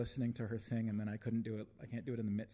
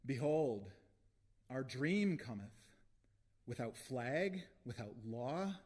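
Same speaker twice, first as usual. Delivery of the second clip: raised, stretched declamation; rousing proclamation; 3 semitones higher, slower than usual. Real, not acted. Poet closing the poem with a vision, proclaimed. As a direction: proclaim the vision with swelling vowels.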